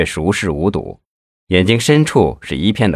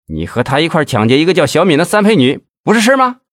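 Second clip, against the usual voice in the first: higher, rising to a furious yell; cursing tirade; 5 semitones higher than usual. A narrator's reading. Interrogator curses the suspect, building to a furious yell. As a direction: tear into him, building to a shout. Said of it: "庄小宝破口大骂".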